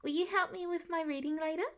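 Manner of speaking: put-on small child asking, high and hushed; a shy kindergartner's request. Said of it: "Nate's quieter voice".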